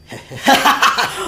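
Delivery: sudden loud, high cackle; jump-scare cackle right in the ear; imitated sinister snickering. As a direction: burst into a mocking ghostly laugh. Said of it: "there's a snickering or somebody's laughing".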